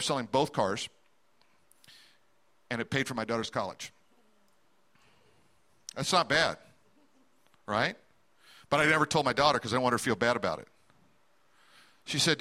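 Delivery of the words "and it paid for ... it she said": normal talking voice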